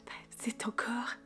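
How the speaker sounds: soft whispered aside; whispering. Real, not acted. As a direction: breathe it out as a whisper.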